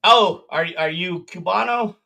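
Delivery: ordinary talk, nothing marked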